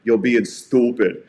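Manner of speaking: slow, firm scolding from a big guy; a big Boston man slowly scolding rowdy fans; stern Boston dad. Retold or imitated. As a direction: lay it down slowly like a huge biker dad. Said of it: "You know what he said".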